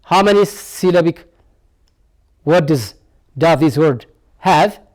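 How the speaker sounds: usual speaking voice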